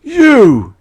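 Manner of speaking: loud, very high shriek. Acted. Enemy shrieks at the narrator, loud and high. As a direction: shriek in shock and rage. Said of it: "He shrieked".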